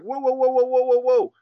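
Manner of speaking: high, loud rising whoa; high, loud alarmed whoa; exclaiming whoa. Retold or imitated. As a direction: stop them in their tracks, way up high. Imitating himself stopping someone.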